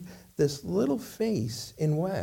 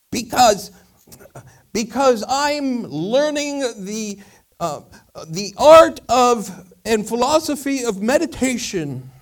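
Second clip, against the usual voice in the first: high, loud, slow philosopher candle; second candle; 9 semitones higher, 9 dB louder, slower than usual. Acted. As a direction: explain slowly and loudly, raised pitch. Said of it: "it says".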